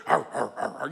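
low, gruff caveman grunts; low rhythmic grunting; mock manly grunts. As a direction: grunt like a rugged hunter. Imitating a rugged hunter type.

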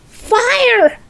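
high, loud alarm cry; yelling. A narrator's reading. Grandma burning inside, yelling for help. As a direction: yell the alarm at full pitch. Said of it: "the old woman yelled".

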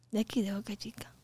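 hushed, voiceless imitation of an answer; whispered reply. Answering where she's from, whispered. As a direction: whisper it as the other person.

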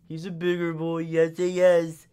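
put-on sing-song good boy; pet baby talk. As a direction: praise the cat like a baby.

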